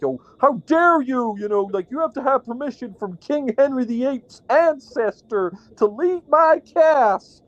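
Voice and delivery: high, theatrical outrage, slowing at the end; mock outraged tyrant. Mocking Joe forbidding a guest to leave, high and outraged. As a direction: play a pompous little king. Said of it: "I wouldn't be like".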